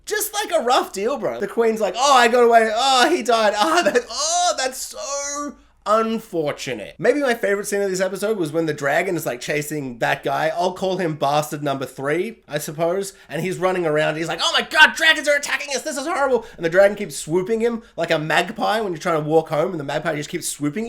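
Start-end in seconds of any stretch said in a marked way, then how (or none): 2.0-6.9 mock insincere queen. 14.4-16.4 mock panic.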